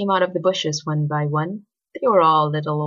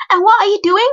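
loud, high, cross challenge; snapped demand; 10 dB louder, faster than usual. A narrator's reading. Girl turning on her schoolmate, snapping loud and high. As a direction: snap it loudly, pitched way up. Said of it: "snapped Ali".

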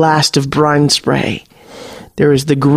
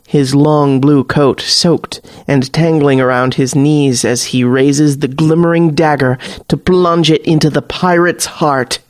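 ordinary delivery

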